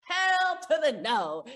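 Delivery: loud, slow, sassy no; emphatic refusal. Acted. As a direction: drag out the refusal with sass.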